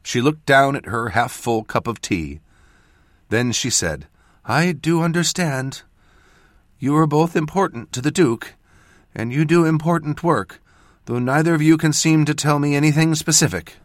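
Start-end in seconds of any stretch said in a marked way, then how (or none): 4.4-13.8 higher-pitched voice for the mother; a raised, earnest mother's voice; lightly voiced mother. A narrator's reading. A mother telling her mage child she understands, earnest.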